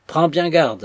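a threat dressed as advice; stern warning. A narrator's reading.

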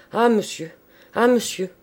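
gasped-out delight, lost for words; flustered, overcome. A narrator's reading. Red-faced old man stammering at his visitor, flustered and overcome. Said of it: "il bégayait".

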